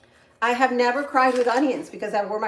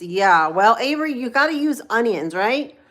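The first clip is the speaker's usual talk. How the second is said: usual speaking voice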